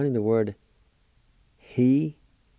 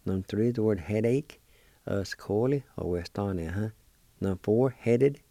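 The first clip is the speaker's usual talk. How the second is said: normal talking voice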